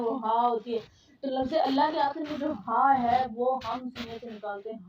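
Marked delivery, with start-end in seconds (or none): 0.2-0.5 ہا pushed from the chest; heavy chest-voiced ہا; ہا said with a strong chest push; chest h sound. Shown as a demonstration. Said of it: "جو ہم سینے سے نکالتے ہیں".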